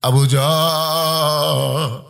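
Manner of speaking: impassioned sing-song preaching; melodic sermon storytelling. A narrator's reading. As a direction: tell it as a chanting preacher, stretching line endings. Preacher tells story in chant.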